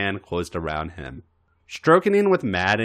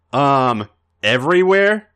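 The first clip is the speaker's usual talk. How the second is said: hopeful; 5 semitones higher, 7 dB louder than usual. Acted. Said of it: "A J tried hopefully".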